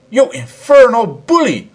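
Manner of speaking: loud, high indignant cry; cried out. A narrator's reading. Narrator to the professor, a loud high cry. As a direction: cry out at the bully. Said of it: "I cried".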